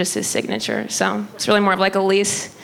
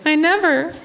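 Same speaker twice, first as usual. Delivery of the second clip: high-pitched indignant mom impression; mock-affronted high mom voice; mom's voice; 8 semitones higher than usual. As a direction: imitate mom protesting, pitched up. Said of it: "she's like".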